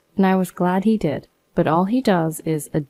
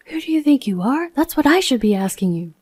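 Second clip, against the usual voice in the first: sudden high raised voice; 7 semitones higher than usual. Retold or imitated. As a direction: fire the question back at him. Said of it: "I raised my voice unexpectedly".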